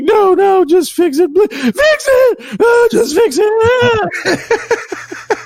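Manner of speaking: very high, drawn-out hysterical wail; hammed-up screechy pleading, stretched out long; mock wailing. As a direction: imitate a woman sobbing and begging. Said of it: "she's still wailing and screaming".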